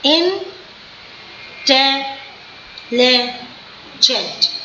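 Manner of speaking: "intelligent" drawn out very slowly in four separate syllables; slow classroom breakdown of "intelligent". Shown as a demonstration. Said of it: "four times it is going down".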